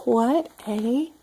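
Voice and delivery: ordinary delivery, nothing marked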